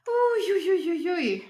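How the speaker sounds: loud high singsong whooping; excited whoop. Real, not acted. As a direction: whoop it up to greet the crowd. Cheering arriving raiders.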